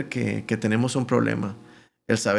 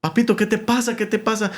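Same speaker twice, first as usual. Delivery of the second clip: high-pitched, anxious questioning; acted concern, pitched way up; worried grandfather; 9 semitones higher than usual. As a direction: imitate a grandfather fussing over a hurt child. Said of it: "muy preocupado, por supuesto".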